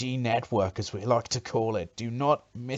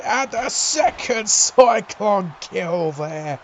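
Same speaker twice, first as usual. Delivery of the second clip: slow, higher, louder drawn-out call; excited; 6 semitones higher, 6 dB louder, much slower than usual. Acted. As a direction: stretch the call as the finisher lands. Finisher hits again.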